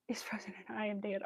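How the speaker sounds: soft, nearly whispered repeat; hushed disbelief. Real, not acted. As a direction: say it quietly, stunned by the absurdity. Repeats the absurd line to self.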